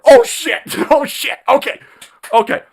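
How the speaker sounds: high, loud mock panic; mock panicked oh shit. Host mocking opponents' panic, a high mock yelp. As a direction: yelp it like you just got caught.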